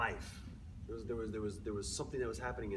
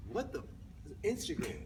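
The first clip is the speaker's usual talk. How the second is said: higher, incredulous; mock disbelief; 5 semitones higher than usual. Real, not acted. Speaker to audience, mock incredulous. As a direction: act floored by the very idea.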